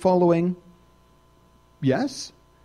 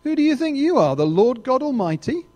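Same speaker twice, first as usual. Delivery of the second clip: higher and louder, incredulous challenge; indignant scoff; 7 semitones higher, 4 dB louder than usual. Retold or imitated. Onlooker scoffing at a student forgiving sins, higher and louder. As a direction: scoff at the student as if he's lost his mind. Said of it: "You'd think".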